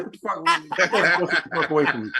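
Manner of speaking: laughing through a scornful put-down. Retold or imitated. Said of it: "I was like".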